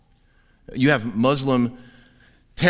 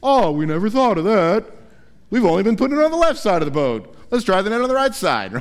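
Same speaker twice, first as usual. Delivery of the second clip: higher, louder mock sarcasm, quick; sarcastic fishermen; 6 semitones higher, 3 dB louder than usual. Fishermen mocking the stranger's advice, sarcastic. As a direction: roll your eyes at the obvious. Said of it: "they've gotta be thinking".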